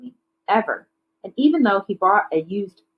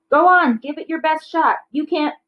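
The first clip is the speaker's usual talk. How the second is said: much higher, louder eager taunting call; excited taunt; 9 semitones higher, 3 dB louder than usual. Acted. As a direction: egg them on, bright and teasing. Taunting at a dunk tank.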